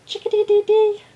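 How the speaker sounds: high, loud birdcall; chickadee call. Acted. Teacher imitating local birds, high chickadee call. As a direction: pipe it out like a chickadee. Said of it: "We have little chickadees".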